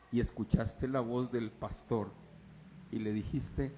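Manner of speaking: ordinary talk, nothing marked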